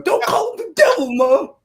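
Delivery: high, loud whine through laughter; cracking up while protesting to mom; laughing and whining at his mom. Retold or imitated.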